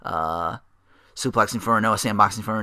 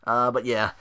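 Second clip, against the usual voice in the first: ordinary talk, nothing marked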